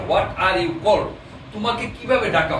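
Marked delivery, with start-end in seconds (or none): 0.1-1.1 each word of the literal English drawn out slowly; walk the learner through it slowly; very slow teaching read; very slow demo. Shown as a demonstration. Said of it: "অর্থ হবে".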